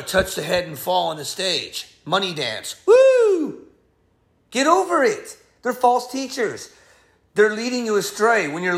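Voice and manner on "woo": high loud mocking woo; hammed-up celebratory woo; mock whoop. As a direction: whoop like a showman preacher. Mocking money dance.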